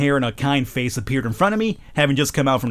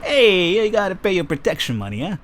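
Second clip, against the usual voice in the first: mock English mobster; 5 semitones higher than usual. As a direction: lean on them like a cartoon mobster. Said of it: "They're, they're English mobsters".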